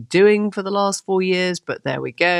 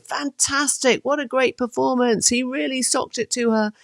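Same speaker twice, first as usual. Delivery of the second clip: mock spin-room enthusiasm; 10 semitones higher than usual. Party spinner raving to reporters in the spin room, mock enthusiasm. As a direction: pretend to rave about your candidate's performance.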